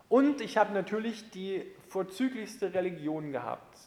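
ordinary talk, nothing marked

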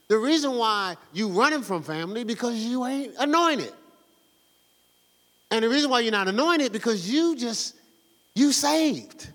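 ordinary talk

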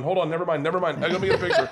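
high, flustered retelling with a laugh; freaked out. Retold or imitated. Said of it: "And I freaked out".

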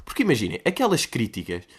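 ordinary talk, nothing marked

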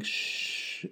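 soft unvoiced shush. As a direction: hush the baby with a shh. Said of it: "with my mic muted going".

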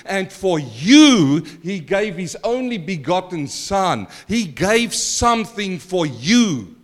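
slow, weighty preaching over music; preaching emphasis. Acted. Preacher to church, slow and weighty. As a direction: lay each word down slowly.

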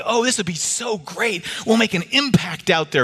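high, gushing mock delight. Preacher parodying churches' self-serving excitement, with high mock gushing. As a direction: gush like a starry-eyed committee. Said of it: "We say".

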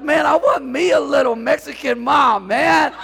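shouted, high and loud; excited shout. Retold or imitated. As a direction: holler it out with joy and a laugh. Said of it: "I'm like".

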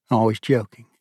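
usual speaking voice

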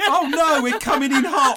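mock alarm. Pretend balloon crash.

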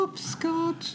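put-on very high, eerie horror-film child; creepy little girl. Scary little girl playing hopscotch in a horror film, creepy child voice. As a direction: draw it out high and soft like a spooky child. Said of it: "Yeah, scary little girls".

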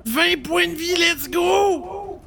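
excited. Real, not acted. Cheering a healing roll.

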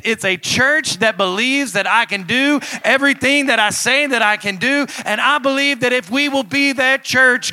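high, loud, impassioned preacher; fervent sermon climbing to a sustained shout; full-force preaching. Real, not acted. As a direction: drive it home to the congregation.

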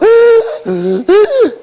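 high, strained gasps like a man on oxygen; winded gasping. Shown as a demonstration. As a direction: gasp for air mid-run. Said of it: "sound like a, a man on oxygen".

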